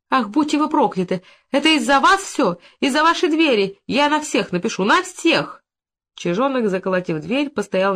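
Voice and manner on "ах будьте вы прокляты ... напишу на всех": high-pitched indignant tirade; accusing, exasperated outburst; outraged cursing. A narrator's reading. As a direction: rail at the neighbours in a high voice. Trapped woman curses the neighbours.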